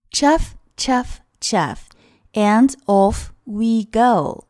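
chug it out like a train pulling off; train chugging. Shown as a demonstration. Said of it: "火车启动的声音". (模仿火车启动的声音)

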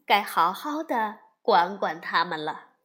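usual speaking voice

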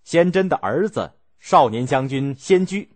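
usual speaking voice

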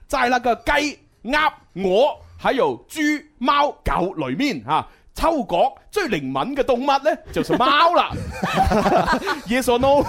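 slow, loud Mandarin with a thick Cantonese accent. Shown as a demonstration. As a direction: read it in heavily Cantonese-accented Mandarin, laughing. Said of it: "我們要有廣東口音的普通話來讀".

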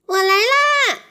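high, excited cry of a little mouse; excited shout. Acted. Mouse shouts to friends in the pond, high and excited. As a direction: shout it with joy as you jump. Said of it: "小老鼠兴奋地大叫着".

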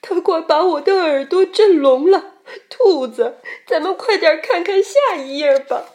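mildly scared, high and loud; trembling. A narrator's reading. Xiaopang urges the rabbit to turn the page, mildly trembling. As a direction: fret aloud as if shivering. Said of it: "小胖打着哆嗦说".

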